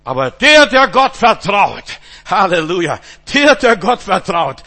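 loud, slow, proclaiming cry; climactic preaching shout. Acted. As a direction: proclaim it at full force.

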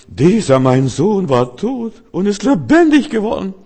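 high, loud, drawn-out proclamation; overjoyed father. Acted. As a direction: act out a father rejoicing, slow and ringing. Voices father welcoming prodigal son home.

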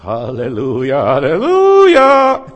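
drawn-out halleluja rising to a loud high cry; joyful shout. Shown as a demonstration. Preacher before the mirror shouting halleluja in joy. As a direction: cry it out in triumph, slow and soaring.

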